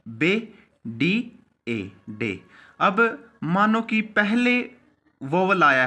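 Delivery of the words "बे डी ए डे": drawn-out, each letter on its own; slow spelled letters. Shown as a demonstration. Teacher spelling D and A out slowly for students. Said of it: "बी dark करना है".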